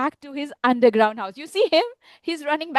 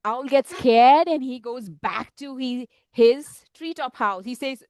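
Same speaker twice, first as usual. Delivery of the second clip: plain reading voice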